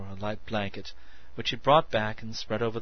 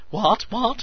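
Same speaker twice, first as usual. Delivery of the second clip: excited; 12 semitones higher, 9 dB louder than usual. A narrator's reading. Meadows excited voice.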